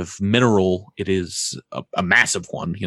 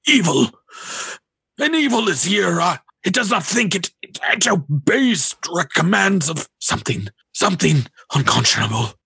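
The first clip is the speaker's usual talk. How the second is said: prophetic alarm; 10 semitones higher than usual. Acted. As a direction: cry out in a seer's trance. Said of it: "and he says".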